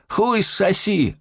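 very high, drawn-out yell; yelled insult. A narrator's reading. Robber yells an insult back at police. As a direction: hurl the obscenity back slowly and high. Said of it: "ору".

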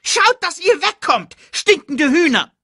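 hohe, schimpfende Erdmännchenstimme; Geier verjagen. Acted. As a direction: die Geier hoch und frech wegscheuchen. (hoch und keck schimpfend)

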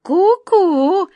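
high, loud, drawn-out cuckoo call; pleased cuckoo. Acted. Cuckoo confirming the wizard's guess, pleased. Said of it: "с довольным видом посмотрела на него кукушка".

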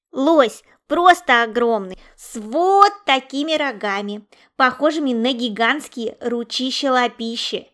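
loud, booming wonder at something huge; big wide-eyed storytelling, very loud; awed excitement. A narrator's reading. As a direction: boast loudly, stretching out how enormous it is. Яша brags about huge moose.

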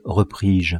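ordinary delivery

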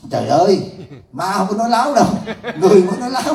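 laughing protest. Retold or imitated.

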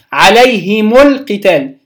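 عليهم القتال joined as "alayhimu l-qital"; short u linking "him" to القتال; mixed linking form. Shown as a demonstration. Teacher showing the third form, عليهم القتال as "alayhimu l-qital". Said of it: "ثلاثة أوجه".

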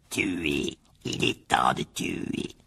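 low, slow, whispery killer; cold deadly voice. A narrator's reading. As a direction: breathe the threat out, drawn long. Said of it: "de plus en plus faible". (voix mortelle, grave et soufflée)

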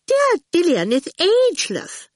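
very high, gushing reproof; reprovingly. A narrator's reading. Miss Potter defends Dillion to Awful, reprovingly. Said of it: "Miss Potter said reprovingly".

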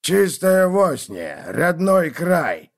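slow, distinct fanatical proclamation; crazed old man. Acted. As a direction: declare it like a zealot. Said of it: "отчётливо произнёс безумный старик".

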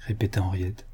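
ordinary delivery, nothing marked

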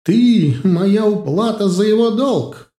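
loud, high furious shout. Acted. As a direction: yell the claim. Princess still shouting. (яростный крик)

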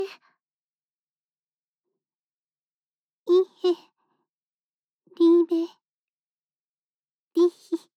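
slow, loud, nervous German confession; blurted confession. Acted. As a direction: force the words out loudly and slowly. (思い切って叫ぶ告白)

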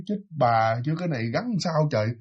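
soft, fast muttering, half under the breath; muttering to herself. Retold or imitated. Old woman stuck on a bike chain, mutters softly to herself. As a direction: puzzle it out quietly to yourself. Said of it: "nói".

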